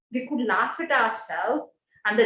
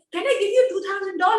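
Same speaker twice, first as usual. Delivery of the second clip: high, fast, half-crying plea; pleading, near tears; 8 semitones higher, 3 dB louder, faster than usual. Retold or imitated. Pleading with a school head to take dolls, fast and half-crying. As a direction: beg them, voice cracking upward.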